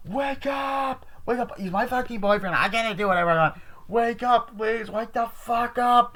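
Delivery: high-pitched pleading calls; hammed-up frantic wake-up cries; mock desperate calling. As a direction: girlfriend calling for her boyfriend, high and pleading. Girl calls for her boyfriend.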